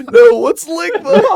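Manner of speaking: quoting mom while cracking up with laughter; can barely get mom's line out for laughing; laughing through quote. Retold or imitated. Retelling the prank to friends, laughing through mom's line.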